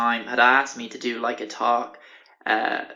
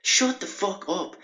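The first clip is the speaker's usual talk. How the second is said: much higher, very slow seething threat; imagined slow furious snap; furious retort; 8 semitones higher, much slower than usual. Retold or imitated. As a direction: spit it out slowly, barely holding back rage. Wants to snap at guy.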